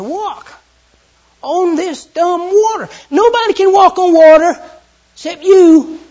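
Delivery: loud, high exasperated protest. Acted. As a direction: shout it like it's impossible. Peter protests to Jesus.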